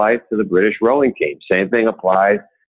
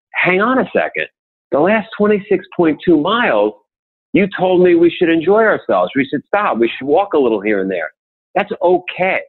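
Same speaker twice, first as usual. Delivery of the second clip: raised, pointed comeback to his wife; emphatic retort; 10 semitones higher than usual. Retold or imitated. Husband reminding his wife at the finish line, higher and insistent. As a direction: throw her words back at her, higher and insistent.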